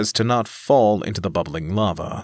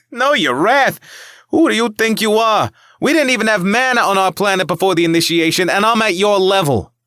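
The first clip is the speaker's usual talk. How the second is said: loud, high, indignant outburst; scoffing; 11 semitones higher, 7 dB louder than usual. Acted. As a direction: yell it back in disbelief. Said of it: "Anthony scoffed".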